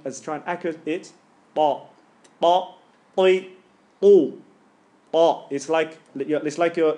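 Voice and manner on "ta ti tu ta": "Ta, ti, tu" struck hard and getting louder; heavy ta. Shown as a demonstration. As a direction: hit each syllable like a tabla drum. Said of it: "hitting a tabla".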